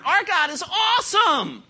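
slow, high, loud shout; preaching shout. Real, not acted. Preacher crying out to the congregation, slow shout. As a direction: drag it out and shout it to the rafters.

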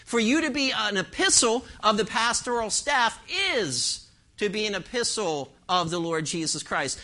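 ordinary talk, nothing marked

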